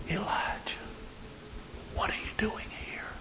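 very soft, breathy whisper. Acted. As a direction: whisper it as God's still small voice. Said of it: "and he's whispering".

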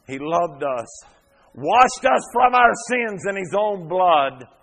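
high, loud, slow preaching that keeps climbing; fiery pulpit preaching, slow and loud; full-force preaching. Acted. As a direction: drive it home from the pulpit, building. Preaching surge.

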